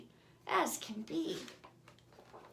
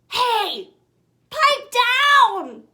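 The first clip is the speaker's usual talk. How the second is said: loud, high, annoyed yell; shout; 10 semitones higher, 14 dB louder, much slower than usual. Acted. Someone yells at snoring truck.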